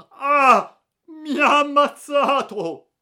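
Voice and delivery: very high, loud pained scream. Acted. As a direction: howl as if shot. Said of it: "si udì un urlo". (urlo di dolore, acutissimo e forte)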